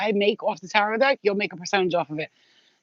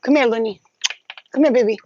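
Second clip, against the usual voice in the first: loud, higher call to the pets; raised pet-calling voice; 5 semitones higher, 6 dB louder, faster than usual. Real, not acted. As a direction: call the animals over, bright and loud.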